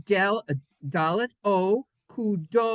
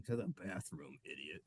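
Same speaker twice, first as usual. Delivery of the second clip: low, much softer put-on voice; gruff imagined viewer; 11 semitones lower, 19 dB quieter than usual. A second imagined viewer answers the first, low and gruff. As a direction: growl out the insult low and dismissive.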